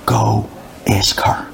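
slow, breathless whisper. Retold or imitated. Poor man telling the butcher to ask the bride, whispered. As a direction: barely breathe the words out. Poor man's hushed answer.

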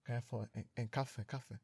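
mumble-soft, as if too shy to be heard; imitated whisper. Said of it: "han viskar så här". (låtsad viskning, tyst)

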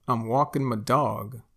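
"walking" as walkin', "my" as muh; Texan reduced forms. Shown as a demonstration. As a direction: drop the g in "walking", shorten "my" to muh. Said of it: "we say ma".

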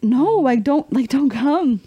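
high, drawn-out plea; mock pleading. Daughter begging dad to stay away, mock pleading.

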